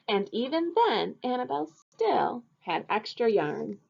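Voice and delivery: storybook reading. A narrator's reading. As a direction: read it to the kids with bright expression. Teacher reads picture book aloud.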